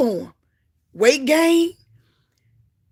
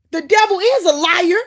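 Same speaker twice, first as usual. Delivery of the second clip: raised, fervent preaching; preaching at full force; 6 semitones higher, 5 dB louder than usual. Real, not acted. Preacher urging the congregation, fervent and loud. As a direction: drive it home to the church.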